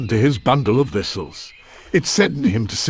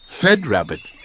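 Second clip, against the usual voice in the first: plain reading voice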